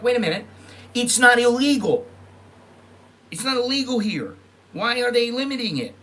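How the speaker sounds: high, loud disbelieving protest; indignant outburst. Retold or imitated. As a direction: rise up in outrage at the ruling. Indignant retelling of own reaction.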